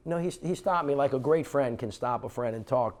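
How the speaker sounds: usual speaking voice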